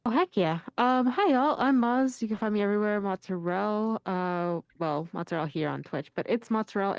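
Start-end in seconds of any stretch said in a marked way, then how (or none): none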